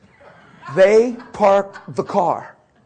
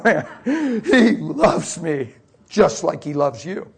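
usual speaking voice

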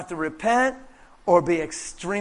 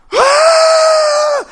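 high, loud joyful whoop from the crowd; crowd shout; 12 dB louder than usual. Retold or imitated. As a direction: shout like the whole porch erupting. Crowd erupts in joy.

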